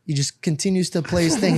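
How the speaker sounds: ordinary delivery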